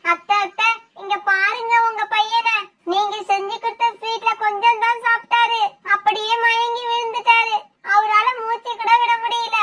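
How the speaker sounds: normal talking voice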